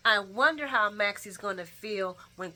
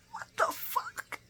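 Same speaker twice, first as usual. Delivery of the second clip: slow, whispered mock shock; drawn-out breathy mock disbelief; stunned whisper; 5 dB quieter, slower than usual. Acts Sonny's stunned reaction.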